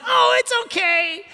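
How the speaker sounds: very high, simpering falsetto; hammed-up pushover voice; mocking weak voice. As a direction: squeak it out like a pushover. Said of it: "Jesus didn't sit there and say".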